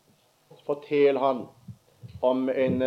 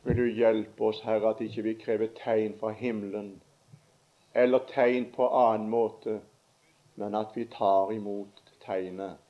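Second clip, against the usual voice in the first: prayer; 4 semitones lower than usual. Acted. Closing prayer.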